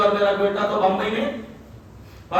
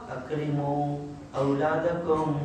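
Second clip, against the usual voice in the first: slow, softer, lower recited Arabic; Arabic recitation; 6 semitones lower, 7 dB quieter, much slower than usual. Acted. Preacher quotes the Prophet to parents, soft slow recitation. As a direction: intone the Prophet's words gently and slowly. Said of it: "کتنے پیارے انداز میں کہا".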